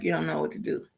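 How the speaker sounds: hushed aside whisper. Real, not acted. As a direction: mutter it under your breath. Muttered aside.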